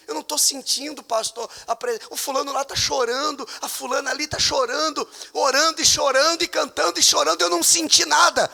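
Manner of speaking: rising high whine of a complaining believer; mock jealous churchgoer; 1 mock doubter. Mocking doubter in sermon.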